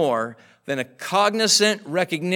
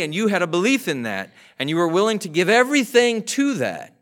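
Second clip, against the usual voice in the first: higher, louder preaching; preaching at full force; 4 semitones higher, 3 dB louder than usual. Real, not acted. Preacher to congregation, raised, forceful preaching. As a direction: press the point home.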